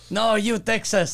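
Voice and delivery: mock French stranger insisting; imitating broken French-accented English. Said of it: "in his fucking fake French accent".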